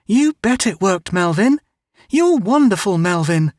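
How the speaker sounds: put-on high, earnest little girl; Mary Ann talking to her brother Melvin, high girlish voice; young girl voice. A narrator's reading. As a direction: voice the sister, bright and eager.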